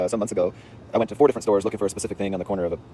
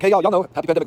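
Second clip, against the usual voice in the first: raised, loud eager greeting; excited calling out; hailing allies; 8 semitones higher, 6 dB louder than usual. Retold or imitated. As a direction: call your friends over to back you up.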